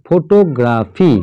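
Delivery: Photography slowly, as ফো, টো, গ্রা, ফি; syllable by syllable. Shown as a demonstration. Another four-syllable example, photography split as ফো-টো-গ্রা-ফি. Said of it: "এখানেও চারটে".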